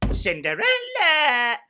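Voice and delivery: high, drawn-out calling shout; bossy stepsister yelling for the servant. Retold or imitated. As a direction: call her from across the house. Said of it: "I shouted".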